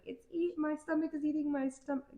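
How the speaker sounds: high, silly voice for a growling belly; voicing her stomach. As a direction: give her hungry stomach a squeaky voice. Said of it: "my body's like".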